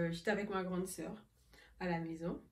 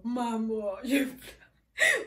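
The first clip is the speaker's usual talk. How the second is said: laughing hard; 7 dB louder, much slower than usual. Real, not acted. Acting herself sobbing.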